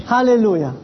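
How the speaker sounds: ordinary talk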